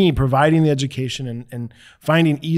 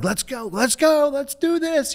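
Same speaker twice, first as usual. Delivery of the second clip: high, pitched-up rallying call; mock hype, pitched way up; hyping up the room; 11 semitones higher, 3 dB quieter than usual. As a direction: imitate pumping up a crowd. Retold forced hype to the room.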